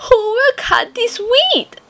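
high hen voice asking. A narrator's reading. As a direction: ask like a hardworking little hen. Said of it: "the little red hen asked".

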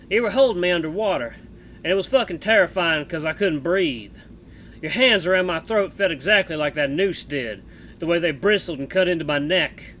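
put-on loud, drawling cowboy accent; Misha cowboy accent. Streamer voicing Misha the bat to Jenna, cowboy accent. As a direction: play the bat as a rough cowboy, American West accent. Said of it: "cowboy accent for Misha".

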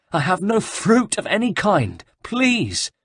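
high, pleading monk voice; desperate pleading. Acted. Beaten monk pleads with the guards, high and desperate. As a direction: beg for mercy through the pain.

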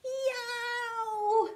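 long high yowl; drawn-out pained cry; howl of pain. A narrator's reading. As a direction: howl as if hurt. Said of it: "howled Tiny".

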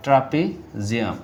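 Trapezium repeated slowly, syllable by syllable; slow dictation. Shown as a demonstration. Teacher repeats trapezium slowly for students writing notes. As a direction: spell out trapezium slowly so they can write it. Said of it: "আপনারা এটা লিখে রাখতে পারেন".

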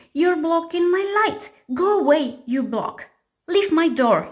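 high, loud frightened yell; frightened triangle yelling in the dark; scared shout. Acted. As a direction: shoo the block away in panic. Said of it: "afraid of the dark".

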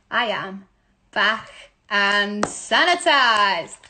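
slow, drawn-out comeback announcement; playful announcement. Pretend. Back from a break to tell viewers, drawn-out playful announcement. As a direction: stretch it out and swoop up on the last word.

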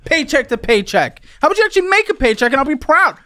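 high-pitched, exasperated yelling; real heated scolding; outraged tirade. Real, not acted. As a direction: yell in disbelief, then mock their excuse. Host rages at guest.

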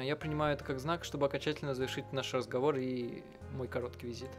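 ordinary delivery

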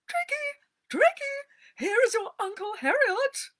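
high, loud, gushing society lady; fluttery old lady shrieking with joy; delighted cry. Acted. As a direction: squeal with delight at her dog. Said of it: "with a cry of delight".

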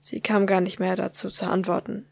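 ordinary delivery

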